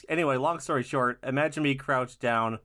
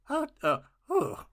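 voice cracking high; 13 semitones higher, slower than usual. Pretend. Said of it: "lost my voice".

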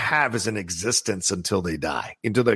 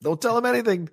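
Lefty impression; 10 semitones higher, faster than usual. Quoting Lefty's line from film.

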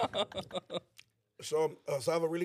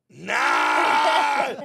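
mock shouted refusal; 3 semitones higher, 9 dB louder than usual. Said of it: "and I'm like".

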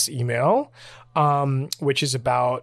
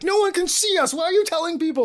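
much higher mock indignant protest; imitating mad friend. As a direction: squeak out an annoyed complaint in fun. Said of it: "he would get really mad".

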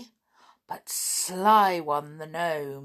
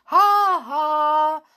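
high, loud, gloating ha ha; gnome's laugh; 8 semitones higher, 8 dB louder than usual. A narrator's reading. Gnome laughs again swooping at the toys, gloating. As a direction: cackle down at the toys. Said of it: "laughed the gnome again".

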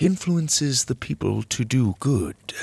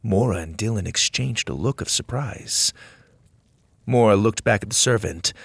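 ordinary delivery

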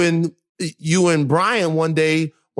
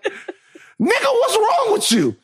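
a high, slow, incredulous dad; imitating dad; much slower than usual. Retelling his dad scolding him for crying, mock incredulous. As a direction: stretch out the disbelief. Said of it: "He'll be like".

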